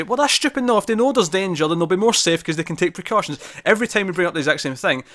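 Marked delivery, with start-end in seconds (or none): 0.0-3.4 high-pitched retold protest; mock protest, pitched well up; exasperated objection. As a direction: argue back in a raised, exasperated pitch.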